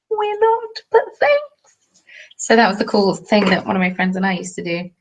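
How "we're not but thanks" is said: very high, squeaky little voice; mock reply. Two friends secretly answering impressed strangers, tiny high mock voice. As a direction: pipe it up high, as a comic aside. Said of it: "We're just like".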